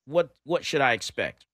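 ordinary talk

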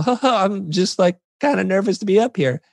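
high, nervous, voice cracking. Retold or imitated. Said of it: "my voice is cracking".

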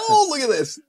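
high, delighted exclamation; mock delight. Fan finding a name in the game, high delight. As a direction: squeal it out in delight. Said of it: "and I'm like".

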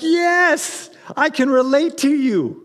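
high, eager yes; eager agreement. Retold or imitated. Preacher reacting to Timothy's tears, eager. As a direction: jump in with delighted recognition. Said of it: "I read that and I'm like".

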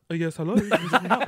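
laughing mock husband voice; put-on man's voice. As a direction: pretend to be the husband. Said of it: "So I was like".

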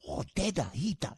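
put-on Japanese-accented customs agent, rising tune; Japanese customs officer accent. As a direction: repeat the Japanese customs guy's delighted recognition. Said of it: "He says that to me".

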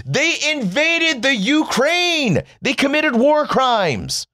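high, drawn-out indignation; incredulous, slow and high-pitched protest; exasperated outcry. Real, not acted. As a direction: stretch each word in high-pitched disbelief. Exasperated rant at complacent listeners.

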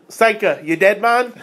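lightly voiced Cool Runnings line in Jamaican accent. Quoting the movie to top his co-host, faint Jamaican accent. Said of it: "I can't even do the accent right".

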